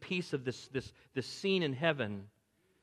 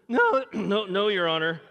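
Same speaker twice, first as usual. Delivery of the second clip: nervous voice crack; 5 semitones higher, 8 dB louder than usual. Retold or imitated. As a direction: voice cracking high and loud like a nervous boy. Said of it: "my voice does that".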